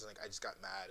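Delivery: ordinary delivery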